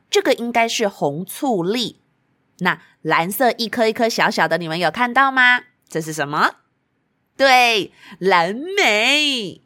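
ordinary delivery

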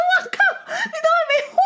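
high, fast, blurted while cracking up; laughing outburst. Retold or imitated. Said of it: "靠，我说：".

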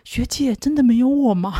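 mock pitiful phone call; pleading bandmate. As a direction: plead like a left-out junior. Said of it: "问我说".

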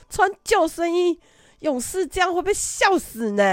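high, loud through laughter; laughing. Real, not acted. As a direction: laugh while repeating their line. Laughing at kids' protest.

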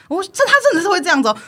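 ordinary talk, nothing marked

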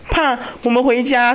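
put-on high, drawn-out child's plea; frightened little boy. Small son begging dad to go home, mock frightened. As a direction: imitate a scared small kid, slowly. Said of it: "因为他那个小朋友很小嘛".

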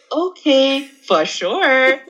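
very slow, drawn-out Okay for sure; mock clueless reply. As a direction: bluff agreement without understanding. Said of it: "我就说". (拖得很长地敷衍同意)

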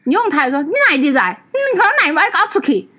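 mock shrill scolding, pitched well up; scolding aunt. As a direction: play the angry aunt chasing you out. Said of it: "她说". (模仿阿姨高声骂人)

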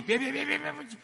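panicked stop. Acted. Nurse stops doctor in panic.

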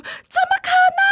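high, slow shocked squeal; disbelief, drawn out high. Retold or imitated. Shocked group-chat reaction.

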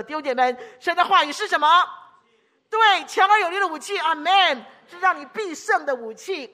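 high, loud pulpit cry; full-force altar call energy; preaching at full force. Real, not acted. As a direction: shout the triumph over the church. Climax of sermon point.

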